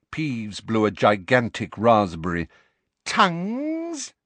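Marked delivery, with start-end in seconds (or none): 3.0-4.1 much higher, slow mocking sprite voice; sing-song teasing as the gleeful poltergeist; taunting poltergeist. A narrator's reading.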